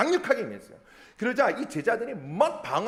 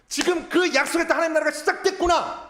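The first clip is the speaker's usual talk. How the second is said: high, raised exclamation of discovery; excited realization; 9 semitones higher, 6 dB louder than usual. Retold or imitated. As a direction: cry out that the promise has come true. 청중의 깨달음을 크게 연기. (벅차서 목소리 높여 외치기)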